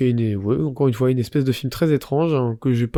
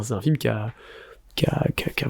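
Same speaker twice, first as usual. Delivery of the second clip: chuchoté; 5 dB quieter than usual. Real, not acted. Parle d'un film choquant.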